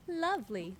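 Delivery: put-on high, lilting Princess and the Frog Southern accent; Elise, Southern accent. Villager greets the player with relief, Princess and the Frog Southern accent. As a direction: play it as a Southern belle. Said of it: "Princess and the Frog accent".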